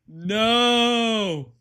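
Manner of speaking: high, loud drawn-out cry of no; long, loud, despairing no; anguished no. Real, not acted. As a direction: howl it as the game dies. Game crashed again.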